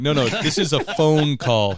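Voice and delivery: exasperated correction. Retold or imitated. Dad explaining a phone call to his son, mock exasperation. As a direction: spell it out loudly, mock exasperation.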